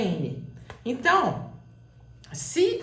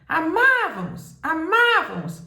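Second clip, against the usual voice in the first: chamado alto e agudo, A tônico esticado; grito para longe; 6 semitones higher, 6 dB louder than usual. Shown as a demonstration. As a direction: grite como quem chama alguém distante. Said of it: "Vamos supor que você grite lá longe". (gritando para longe)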